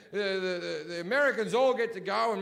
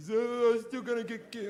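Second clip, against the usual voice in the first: put-on higher childish voice, a gullible kid still waiting for presents; mock naive kid; 5 semitones higher than usual. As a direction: lightly imitate a kid who believes in Santa. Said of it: "like a thirteen-year-old kid who still believes in Santa".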